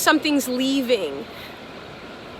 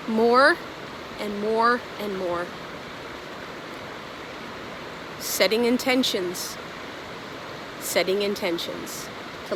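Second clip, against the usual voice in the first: soft guided meditation; 4 semitones lower, 6 dB quieter than usual. Acted.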